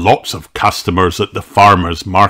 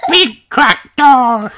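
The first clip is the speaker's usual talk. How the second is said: giggling, very high, slow big tractor; laughing toddler; slower than usual. A narrator's reading. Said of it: "cried George".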